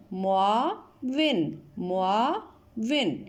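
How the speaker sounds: معاون drawn out slowly, syllable by syllable; slow pronunciation drill. Shown as a demonstration. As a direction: repeat it slowly for pupils. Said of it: "میم پیش مو".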